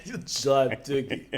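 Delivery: slow, echoed mock Russian accent. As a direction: copy the Russian-accented slang slowly. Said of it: "I would need that in a Russian accent".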